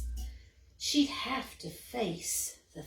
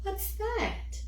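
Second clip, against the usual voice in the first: small scared squirrel; 10 semitones higher than usual. Acted. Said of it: "she whispered to her dad".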